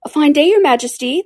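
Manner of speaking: plain reading voice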